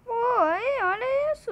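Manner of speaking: can't believe it, show everyone; excited outburst. Real, not acted. Streamer calling viewers to look at the cheater, excited outburst.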